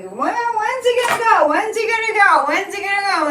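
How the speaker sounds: high, whiny mock impatience, repeated; impatient viewers. Viewers nagging the host, whiny and impatient. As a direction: mimic nagging viewers pestering when. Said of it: "How many people kept asking me".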